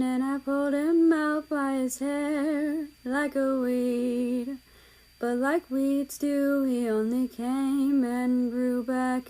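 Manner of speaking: half-sing it slowly like a ballad; slow lyrical reading of song words; poem recital. A narrator's reading.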